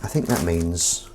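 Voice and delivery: ordinary talk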